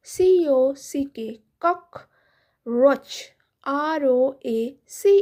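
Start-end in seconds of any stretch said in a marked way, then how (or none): 1.6-3.1 ককরোচ stretched out very slowly; slow model word. Shown as a demonstration. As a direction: spell out ককরোচ slowly for kids. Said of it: "ইংলিশে বলা হয়". (ককরোচ খুব ধীরে টেনে বলা)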